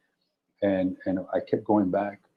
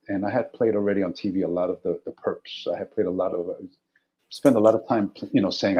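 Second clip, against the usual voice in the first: ordinary delivery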